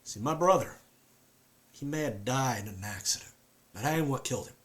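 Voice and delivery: a haunted Southern man; southern accent. Acted. Performs Luke with southern accent.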